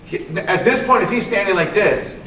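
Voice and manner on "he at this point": ordinary talk, nothing marked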